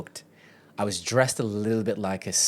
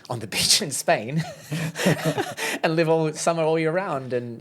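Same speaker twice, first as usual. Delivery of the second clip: laughing; 7 semitones higher, 4 dB louder than usual. Real, not acted. Laughing while describing dream life.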